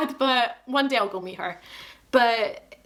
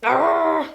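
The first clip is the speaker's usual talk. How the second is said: loud, raised groan of self-cringe; embarrassed groan; 7 semitones higher, 6 dB louder than usual. Real, not acted. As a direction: groan aloud at your own awkwardness. Cringing after watching her emotional clip.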